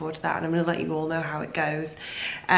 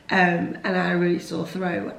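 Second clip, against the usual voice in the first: ordinary delivery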